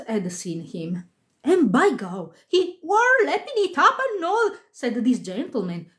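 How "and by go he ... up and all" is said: high-pitched, exclaiming stagehand; gossiping stagehand. A narrator's reading. Stage hand reporting the actor's drinking, high and exclaiming. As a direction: pitch leaping up in amused amazement.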